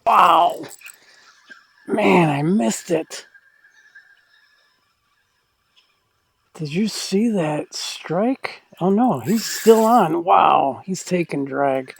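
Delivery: excited, breathless reel-in; fighting a fish. Real, not acted. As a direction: caught up in the fight.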